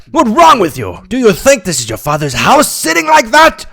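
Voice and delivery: high-pitched indignant telling-off; angry scolding. A narrator's reading. Manager scolds supposed worker for sitting, angry.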